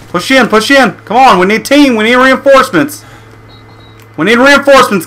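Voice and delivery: high, pressing calls for backup; urgent shouting. Real, not acted. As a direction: shout for help to the team. Pinned down, calling for help.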